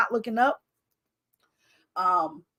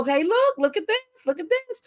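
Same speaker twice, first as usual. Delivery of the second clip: lightly voiced higher call, rising on this; God's nudging voice; 8 semitones higher than usual. She voices God nudging her toward inspiration, a light higher call. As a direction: play God eagerly pointing something out.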